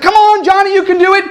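high shouted cheer; mock scream. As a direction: scream encouragement at the lifter. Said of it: "he's screaming at him".

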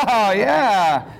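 high, laughing burst of approval; delighted cheer. Acted. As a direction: revel in it, laughing and drawing it out.

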